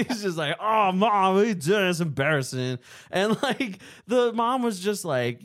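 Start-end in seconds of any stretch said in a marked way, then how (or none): 0.6-2.8 louder whiny mock son; embarrassed son. A twenty-year-old embarrassed by his mom, whiny mock. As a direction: whine at mom like an embarrassed kid. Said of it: "That's what he sounded like".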